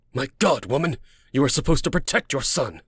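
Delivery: indignant outburst; angry scolding. Acted. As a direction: scold her in disbelief. Enraged man scolds the mother.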